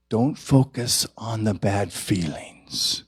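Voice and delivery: whispered, mocking the old teaching. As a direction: hush it like a secret rule. Mocking old New Thought teaching.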